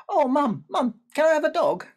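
put-on high, loud pleading boy voice; excited child. A narrator's reading. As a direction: call out to mum, begging eagerly. Child begging again.

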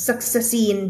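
"successine" sounding out both S and C as sak-sa-seen; misread SCIENCE. Shown as a demonstration. Teacher warning students off a misreading, "successine". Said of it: "ไม่อ่าน successine".

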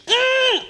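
high hum of craving. Real, not acted. Craving the steak she bought.

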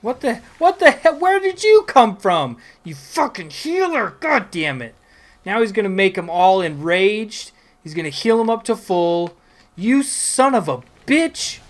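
loud, high furious yelling at the healer; staged rage. Acted. Gamer yelling at an enemy healer, hammed-up fury. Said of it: "put a little more, you know, theatrics".

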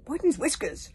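loud, high, outraged; angry voice from above telling them not to fire; furious yell. A narrator's reading. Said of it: "yelled a furious voice".

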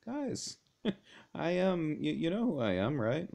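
high, chummy voice for Jesus reassuring his friends; casual Jesus voice. Retold or imitated. Jesus chiding the disciples in the boat, high and friendly. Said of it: "He said".

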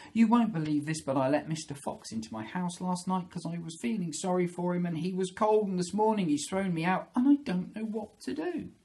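high-pitched rush of woe; upset rabbit. Acted. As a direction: pour out your troubles as a little rabbit. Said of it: "she said".

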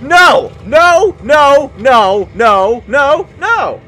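loud scream, then repeated high cries; panicked yelling. Real, not acted. Gamer rejecting a monstrous mob, panicked yelling. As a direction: shout each refusal as its own burst.

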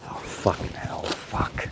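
soft, unvoiced curse of despair; whispered curse. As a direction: breathe the swearing out as it sinks in. Realizes the money all goes to one account.

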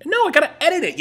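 high, loud snap; exasperated. Real, not acted. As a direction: bark it back, exasperated.

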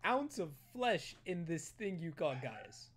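loud, high, drawn-out protest; loud insistence. Acted. Player insisting to Peter about Gaius, loud insistence. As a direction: cut through the crosstalk, high and slow. Pleading loudly over crosstalk in game.

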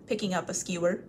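usual speaking voice

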